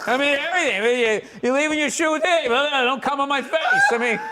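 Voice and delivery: loud, high martyr's rant; over-the-top marital whine; mock whiny spouse. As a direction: play the aggrieved victim at full pitch. Acting out a whining spouse quarrel.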